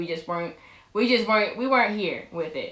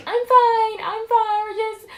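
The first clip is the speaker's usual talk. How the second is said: high, loud whiny retelling; exaggerated high-pitched protesting; mock frantic reassurance; 13 semitones higher, 5 dB louder than usual. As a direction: insist in a very high, hammed-up voice. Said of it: "And I'm like".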